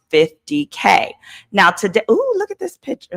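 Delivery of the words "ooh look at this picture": surprised. Real, not acted. Reacts to mugshot photo.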